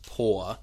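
"poor" with one long "or" vowel, like "paw"; everyday British "poor" rhyming with "door". Shown as a demonstration. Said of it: "you say poor rather than poor".

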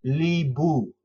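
Libu, clear "lee-boo"; teacher sounding out Libu; last syllables libu. Shown as a demonstration. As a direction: model Libu slowly for a learner. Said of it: "The last two syllables are".

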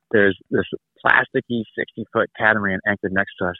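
ordinary delivery

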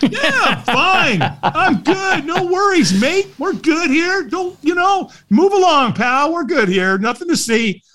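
very high, squeaky fake reassurance; mock-cheery brush-off. Nervous walker waving off a stranger, mock-cheery high voice. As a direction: insist you're fine in a comically high voice.

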